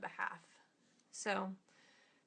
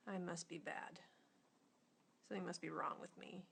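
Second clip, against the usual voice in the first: softer, sinking, almost whispered; a small part giving up on itself; hushed and defeated, trailing to a whisper; dejected inner voice; 9 dB quieter than usual. Retold or imitated.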